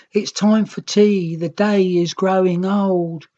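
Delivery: chirpy little Green calling it a day; young Green's voice. A narrator's reading. Young Green telling the Red it's teatime, childlike. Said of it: "The young Green said".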